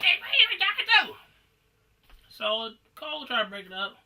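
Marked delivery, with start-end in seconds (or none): 0.0-1.2 high, loud mocking challenge; hostile. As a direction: bark it like a hothead picking a fight. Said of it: "he get all hostile".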